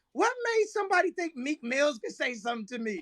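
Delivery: shouting through laughter; laughing shout. Real, not acted. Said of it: "I'm about to start crying, laughing".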